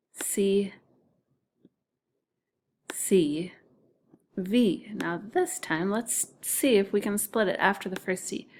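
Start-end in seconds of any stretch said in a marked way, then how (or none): none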